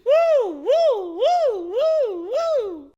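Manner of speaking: high, loud whoops; excited whooping. Real, not acted. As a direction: cheer like at a party. Cheering for big man talk.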